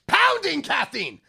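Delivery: loud, high, slow yell; excited shout. Real, not acted. Podcaster hyping his love of caffeine, slow excited shout. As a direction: shout it like a hype cheer.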